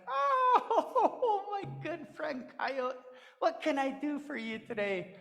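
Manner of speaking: high, laughing friendly tree voice; laughing old tree. Acted. The tree welcomes its friend Coyote, laughing. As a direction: chuckle and welcome your friend.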